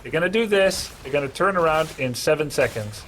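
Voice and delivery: ordinary talk, nothing marked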